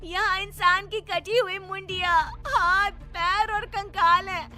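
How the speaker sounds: very high, slow tearful sobbing voice; terrified, crying. Acted. Seema, trapped among bones, cries to Nani in terror. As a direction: sob out her fear from the dark. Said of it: "मुझे यहाँ बहुत डर लग रहा है".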